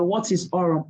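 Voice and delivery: ordinary talk, nothing marked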